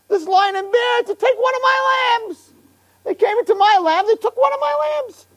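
very high-pitched mock indignation; mock outrage. David telling King Shaul about his stolen lamb, mock outrage.

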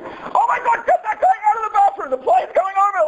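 high, mock-alarmed yell; panicked passenger. Imagined passenger panicking over a man in the bathroom, mock panic.

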